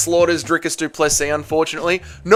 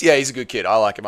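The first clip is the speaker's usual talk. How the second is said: ordinary talk, nothing marked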